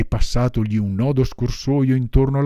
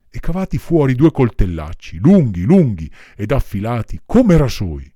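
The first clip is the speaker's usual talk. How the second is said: ordinary delivery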